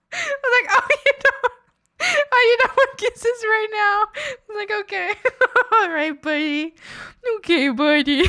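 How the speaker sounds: very high, squealing with laughter; can barely talk for laughing; laughing hard. Real, not acted. Laughing at grumpy dog.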